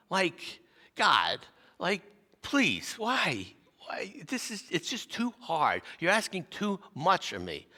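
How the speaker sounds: high, strained cry of protest; begging God, voice climbing; anguished plea to God. Retold or imitated. Driving to work, pleading with God in despair. Desperate prayer in the car.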